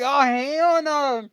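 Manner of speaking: slow drawn-out refusal; mock dread. Seeing the packed truck pull up, slow drawn-out dread. Thinking the cartel pulled up.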